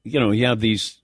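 normal talking voice

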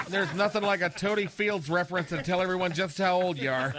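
ordinary talk

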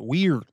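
"Weird" said loud, imitating how they say it; mimicked "Weird"; imitated pronunciation. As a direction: punch "Weird" in imitation of their way. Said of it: "You know how y'all say it".

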